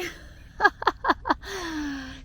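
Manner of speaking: high giggling laugh in a run of bursts; amused laugh. Real, not acted. Gardener amused at her own whispering, giggling.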